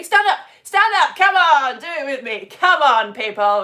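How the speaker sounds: loud, high calling out; rallying shout. Real, not acted. Teacher calling pupils to stand and exercise, shouting. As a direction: rouse the class to jump up.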